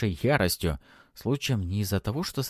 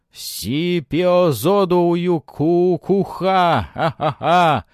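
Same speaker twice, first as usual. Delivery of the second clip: gloating villain; 9 semitones higher, 9 dB louder, much slower than usual. A narrator's reading. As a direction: gloat like a triumphant shaman. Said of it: "довольный Уилла".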